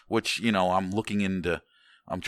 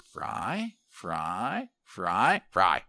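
hopeful questioning, each word swooping sharply upward; mock begging; much slower than usual. Dieting dad eyeing his daughter's food, mock begging. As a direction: wheedle for a bite with a steeply rising lilt.

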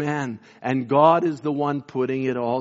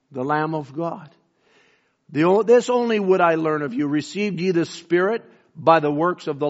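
scripture reading; 4 semitones higher, 3 dB louder than usual. Acted. Preacher reads Paul's rebuke aloud.

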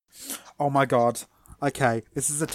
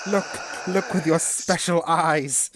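dramatic advert voice; 4 semitones higher, slower than usual. Retold or imitated. Quoting the contact lens advert.

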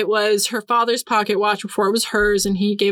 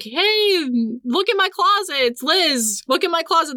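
high, mock yelled call into the well; retold yell; 7 semitones higher than usual. Ghost girl yelling to her family at dinner, mock shout. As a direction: call out to the family through the water. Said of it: "she yells into it".

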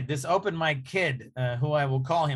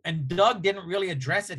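ordinary talk, nothing marked

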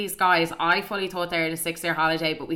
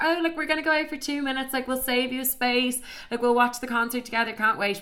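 higher-pitched, airy reassurance; breezy fake promise; 6 semitones higher than usual. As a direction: toss off a sweet excuse in a higher voice. Acting out their excuse to the men.

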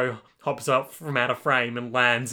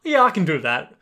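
genie squeals yes high and loud; eager genie yes; 11 semitones higher, 9 dB louder than usual. Pretend. Genie to Polnareff, a high loud eager yes. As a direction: sell the catch with a bright burst.

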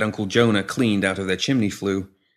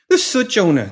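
high, loud anxious plea; high-voiced worried mother; pleading; 11 semitones higher, 5 dB louder than usual. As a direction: plead with the bully, pitched high. Mother begs Jonah for child.

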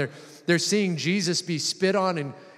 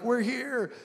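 mock triumphant cheer; 8 semitones higher, 3 dB quieter than usual. Disciples arriving among palm branches, mock cheering. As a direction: imitate celebrating, pitched well up. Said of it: "they just came in shouting".

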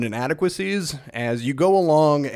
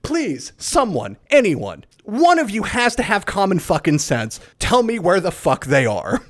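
exasperated pleading; 7 semitones higher, 3 dB louder than usual. Acted. Hero keeps begging while shooting.

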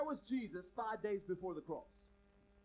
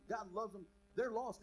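normal talking voice

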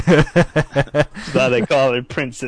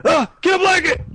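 high, loud panicked cry; panicked yelp; 5 dB louder than usual. Retold or imitated. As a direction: squeal it out in fright. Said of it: "This dude's today".

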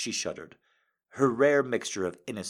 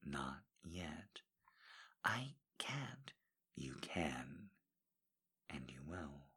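whisper; 3 semitones lower, 18 dB quieter, slower than usual. Acted. Whispered lovers' exchange.

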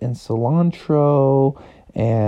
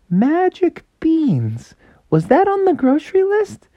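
puzzled Froggy. Retold or imitated. Froggy spotting the beans, wondering aloud, high. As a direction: muse over it, puzzled. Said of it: "he was like".

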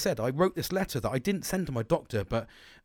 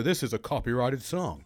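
light American twang on the copyright notice; 3 semitones lower than usual. Retold or imitated. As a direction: mimic an American automated warning with a slight twang. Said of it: "put that little bit of a twang on the end there".

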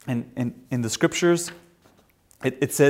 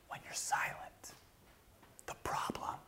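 whisper; 17 dB quieter than usual. Acted. Whispering about silence.

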